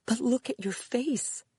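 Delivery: high-pitched motherly dismay; appalled at what you see, voice jumping up; aghast. A narrator's reading. Mother sees her daughter's cut face, aghast. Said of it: "her mother said aghast".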